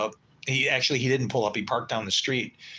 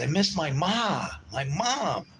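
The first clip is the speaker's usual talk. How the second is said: pleading; 6 semitones higher, slower than usual. Retold or imitated. Crying over his mother.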